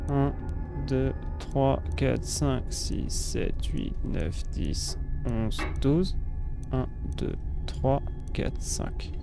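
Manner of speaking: counting in a whisper. Real, not acted. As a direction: count softly under your breath. Counting chimes under breath.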